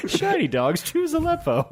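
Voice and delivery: put-on high, old-timey ad announcer; 1940s voice. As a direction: sell it like a 1940s commercial. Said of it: "Why were we all doing nineteen forties voice?".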